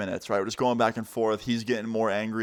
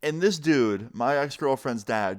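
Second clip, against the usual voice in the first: ordinary delivery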